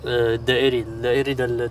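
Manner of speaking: ordinary talk, nothing marked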